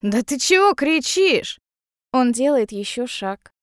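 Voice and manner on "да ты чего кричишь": higher-pitched, mildly indignant question; protesting. A narrator's reading. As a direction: protest at being yelled at. Guest protests at being shouted at.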